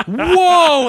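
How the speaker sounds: high, loud startled "whoa"; comically exaggerated shock; acted freak-out. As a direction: recoil in mock panic, voice shooting up. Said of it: "like, freak out".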